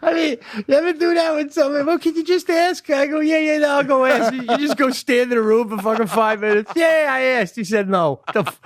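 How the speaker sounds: high, laugh-tinged voice; high amused storytelling; laughing while telling. Real, not acted. Laughing retelling of fake ask.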